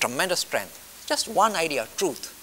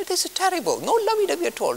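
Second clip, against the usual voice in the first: much higher, hammed-up horrified listener; mock dismay; 13 semitones higher, 3 dB louder than usual. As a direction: play the shocked follower.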